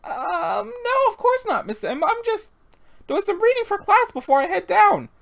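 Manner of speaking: put-on very high-pitched young character voice; flustered innocent girl. Denying to Miss M that a boy is coming, high and flustered. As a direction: squeak out an innocent excuse.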